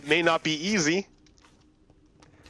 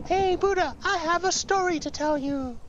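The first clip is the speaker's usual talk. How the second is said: little spider. Acted. Spider offering Buddha a story, very high squeaky voice. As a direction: pipe up like a tiny creature. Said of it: "the spider says".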